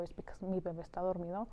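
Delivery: ordinary delivery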